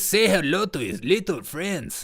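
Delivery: drawn-out, lilting mock delivery; mock gangster quote. Host mocking what Biden says, playful put-on voice. Mimics Biden's odd request.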